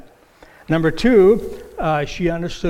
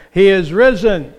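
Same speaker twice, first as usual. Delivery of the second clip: loud call; 2 semitones higher, 9 dB louder than usual. Shown as a demonstration. Pastor leading the congregation, loud call. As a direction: call it out strong to the church. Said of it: "So let's say that together".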